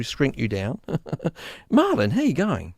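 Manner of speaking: ordinary talk, nothing marked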